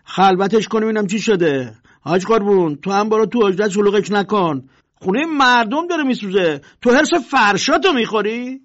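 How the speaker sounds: loud, bossy swagger; the local tough taking charge; arrogant. Acted. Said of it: "مغرورانه کنار زد و گفت".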